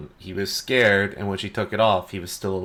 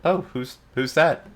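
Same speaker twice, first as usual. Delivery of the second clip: put-on kid's voice; 7 semitones higher than usual. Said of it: "he looks over at Eldrin and he says".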